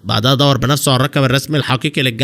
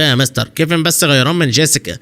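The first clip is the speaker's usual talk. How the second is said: usual speaking voice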